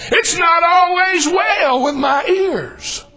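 slow, high, shouted with a cry in it; crying-out preaching. Acted. Preacher to congregation, slow wailing refrain. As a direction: wail the refrain to the church.

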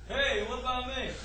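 soft, far-off call; muffled prisoner. Acted. Locked-up grudge calling to the preacher from afar. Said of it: "guess what I'm gonna hear".

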